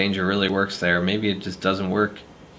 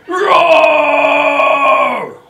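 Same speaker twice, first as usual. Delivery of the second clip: screamed roar, much higher and louder; monster roar; 11 dB louder than usual. Acted. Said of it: "Need more ferocity than that".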